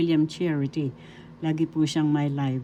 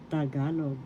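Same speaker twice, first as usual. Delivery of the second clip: ordinary talk, nothing marked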